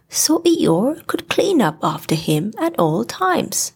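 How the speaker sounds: ordinary delivery, nothing marked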